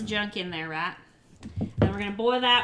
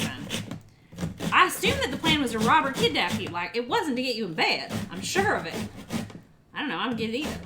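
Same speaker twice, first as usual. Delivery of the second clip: usual speaking voice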